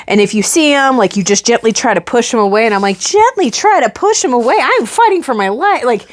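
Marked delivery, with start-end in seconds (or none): 3.0-4.6 high-pitched disbelieving question; retold indignant disbelief; incredulous echo. 4.6-5.8 high, indignant protest; retold exasperation, pitched up; mock outrage. Incredulous reply to the advice.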